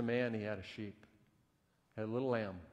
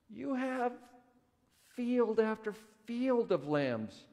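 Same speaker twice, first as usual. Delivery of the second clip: exasperated; 10 semitones higher, 3 dB louder than usual. Retold or imitated. Advisors exasperated with greedy man.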